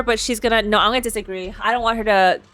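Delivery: normal talking voice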